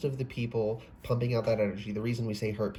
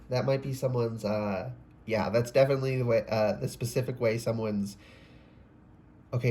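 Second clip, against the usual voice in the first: ordinary delivery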